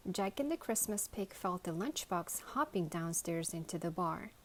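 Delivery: ordinary delivery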